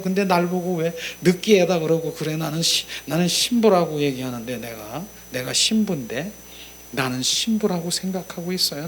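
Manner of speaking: usual speaking voice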